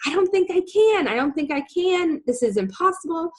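high-pitched, mildly whiny protest; retold self-doubt. Doubting she can earn it back, mildly protesting.

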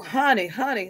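normal talking voice

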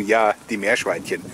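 ordinary delivery

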